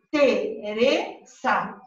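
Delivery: "Teresa" split slowly into Te, re, sa; syllable by syllable. Shown as a demonstration. Teacher showing the class three syllables, "Teresa" slowly split Te-re-sa. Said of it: "se divide en tres sílabas".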